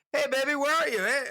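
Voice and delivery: high-pitched goofy searching call; mock husband hunting for his wife. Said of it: "he's calling for his wife".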